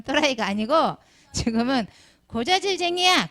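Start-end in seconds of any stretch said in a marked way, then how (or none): 2.3-3.3 high taunting jeer; mock jeer at the teacher; kids taunting. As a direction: call it out like a mocking kid. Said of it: "그런단 말이에요".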